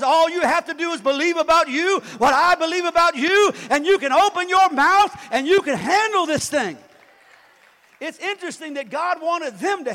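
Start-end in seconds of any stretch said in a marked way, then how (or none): 0.0-6.8 loud, high preacher's cry; preacher voicing God's call to believe; booming preaching. Real, not acted. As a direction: proclaim it with full force. Preaching at full force.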